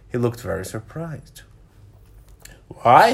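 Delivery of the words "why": loud, questioning exclamation; a short, louder outburst; startled cry. A narrator's reading. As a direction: cry it out in mild surprise. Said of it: "he cried".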